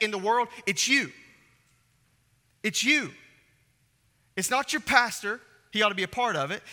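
rising to the peak of the sermon, urging the church; preaching at full force. Acted. Preaching climax to the congregation.

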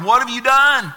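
loud, high, thundering demand; God confronting sinners. Acted. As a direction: cry it out as a booming accusation. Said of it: "God asked that very question of Adam and Eve".

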